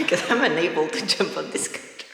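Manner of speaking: normal talking voice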